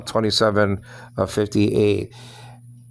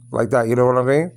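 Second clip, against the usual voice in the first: ordinary delivery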